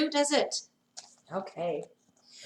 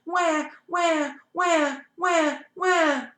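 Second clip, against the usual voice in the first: put-on higher wailing baby cry; mock baby wail, pitched up; 6 semitones higher, much slower than usual. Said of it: "That looks like a very loud cry".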